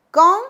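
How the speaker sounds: Quand said loud and high, nasal ending; French quand. Shown as a demonstration. Teacher modelling Quand loudly for the class. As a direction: model Quand with a bright lift. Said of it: "palabras interrogativas principales".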